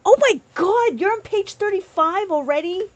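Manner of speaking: high-pitched astonishment; high, astonished question; amazed. Real, not acted. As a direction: exclaim in high, real disbelief. Amazed at viewer's progress.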